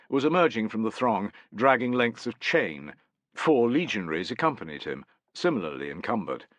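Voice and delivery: ordinary delivery, nothing marked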